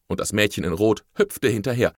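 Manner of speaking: plain reading voice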